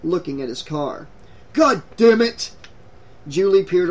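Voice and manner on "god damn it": loud, higher outburst of anger; angry cursing. A narrator's reading. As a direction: curse loudly in frustration. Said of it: "kicked up a cloud of dust and cursed".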